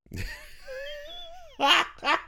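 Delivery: high, wild screaming laugh; shrieking laugh. Real, not acted. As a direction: laugh until it turns to a shriek.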